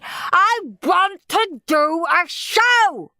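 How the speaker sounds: put-on high, very drawn-out child's demand; slow, high-pitched howl of protest; howling tantrum. A narrator's reading. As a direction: wail each word like a stroppy boy. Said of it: "howled Henry".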